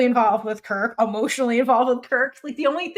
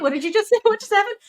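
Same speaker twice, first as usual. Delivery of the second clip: high, loud, baffled squeal; mock bafflement; 10 semitones higher, 5 dB louder, faster than usual. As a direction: act stunned and squeaky. Retold baffled reaction.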